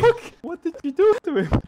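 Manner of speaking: high, loud, appalled question; shocked outcry. Real, not acted. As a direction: cry out in disbelief. Shocked after the wolf is killed.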